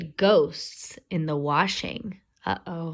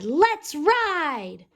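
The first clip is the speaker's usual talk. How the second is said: eager; 7 semitones higher than usual. A narrator's reading. Boys ride downhill.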